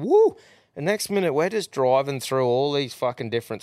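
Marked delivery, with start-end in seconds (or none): 0.0-0.4 high, loud party whoop; excited woo. Retold or imitated. Passenger into the pumping music, excited whoop. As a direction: whoop it out, pitched way up. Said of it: "was into it at the time, going".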